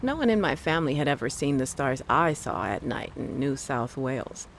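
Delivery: usual speaking voice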